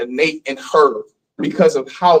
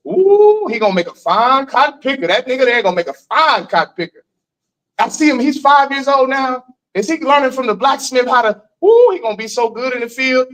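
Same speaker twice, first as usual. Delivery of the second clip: mock oppressor; 7 semitones higher than usual. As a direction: gloat like an overseer sizing up a child. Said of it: "Some oppressor will come up to your mother and say".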